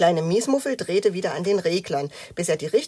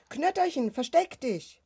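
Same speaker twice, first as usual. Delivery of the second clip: urgent warning; 8 semitones higher than usual. A narrator's reading. Child warns Knötterchen as someone knocks, urgent. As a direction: warn a friend to hide, quick and high.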